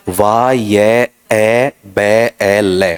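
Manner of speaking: "वा ये ए बे ए ले" broken into separate syllables, very slowly; slow syllable breakdown. Shown as a demonstration. Teacher sounding out viable for students, syllable by syllable. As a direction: stretch each syllable of "वा ये ए बे ए ले" apart like dictation. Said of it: "इस तरीके से".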